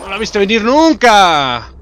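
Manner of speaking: high, loud taunt, drawn out slowly; gloating shout. Real, not acted. Player taunting a beaten enemy, gloating shout. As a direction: crow it out, stretching the last word.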